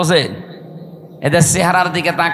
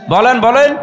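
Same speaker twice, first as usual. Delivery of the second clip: high loud call to answer; urging the crowd; 5 semitones higher, 4 dB louder than usual. Real, not acted. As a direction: demand a reply from the crowd.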